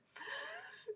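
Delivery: sharp, high swooning gasp. Retold or imitated. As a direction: gasp as if star-struck. Reacting to being stared at.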